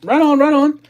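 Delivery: excited. Real, not acted. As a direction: cheer it out, pumped for a friend. Excited reply to chat.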